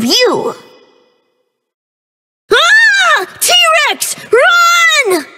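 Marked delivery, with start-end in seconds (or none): 2.5-5.2 high, frantic warning cries; panicked cry. Acted. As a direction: shriek the alarm as the T-Rex appears. Fleeing a T-Rex.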